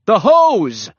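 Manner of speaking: high, loud urgent shout. A narrator's reading. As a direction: shout it across the crowd. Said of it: "shouted someone".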